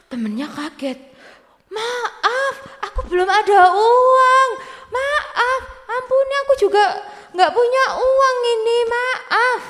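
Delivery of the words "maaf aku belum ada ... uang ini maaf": tinggi, memelas minta maaf; memohon ampun. Acted. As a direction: mohon belas kasihan dengan suara tinggi. (memohon dengan suara memelas)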